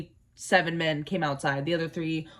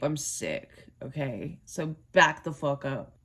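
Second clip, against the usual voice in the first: ordinary talk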